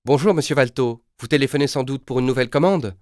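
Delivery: thrilled to hear the caller; delighted. Acted. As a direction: operator welcoming the returning client, delighted. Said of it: "La voix a l'air enchantée".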